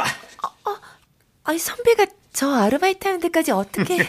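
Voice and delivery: startled surprise. Acted. Surprised by senior's visit.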